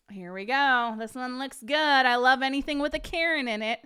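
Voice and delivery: normal talking voice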